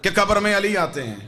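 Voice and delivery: fervent oratory with punched key words; impassioned preaching. Real, not acted. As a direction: preach with force to the crowd. Preaches to congregation at high pitch.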